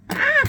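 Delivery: sudden very high, loud yelp of surprise; high startled yelp. Real, not acted. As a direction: let out a sharp squeal. About to show many palettes.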